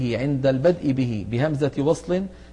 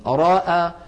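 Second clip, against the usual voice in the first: امرأ said louder, leaning on the ra with a fatha; stressed word; 3 semitones higher, 4 dB louder than usual. Shown as a demonstration. Teacher points out the fatha on the ra in امرأ, emphatic. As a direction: hit امرأ to show the open ra. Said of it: "لاحظوا بأن هذه الكلمة".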